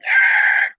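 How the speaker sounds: loud, toneless yelp; mock startled scream. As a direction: let out a comic cry of fright. Startled by the gunny's shout.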